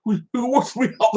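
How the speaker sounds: high-pitched, whimpering imitation; mock wimpy pleading. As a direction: plead like a pathetic weakling, mockingly. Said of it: "like a little bitch".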